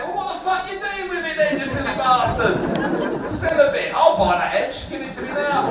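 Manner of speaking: high, loud ranting comeback; exasperated retort. Acted. Comic tells silly farmer to sell a bit, exasperated.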